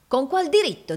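high, heated challenge; affronted, pitch jumping up; indignant demand. A narrator's reading. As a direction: press the question angrily. Villain repeats his demand.

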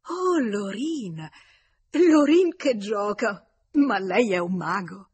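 loud, high, drawn-out astonishment; slow, soaring cry of wonder; amazed exclamation. A narrator's reading. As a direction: marvel openly, stretching the words. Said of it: "e, meravigliata".

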